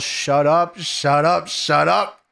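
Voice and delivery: half-yell it, each repeat ending on a rise; mild retold yell. Recapping a wrestler in a backstage argument, mock yelling. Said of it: "Kurt yells".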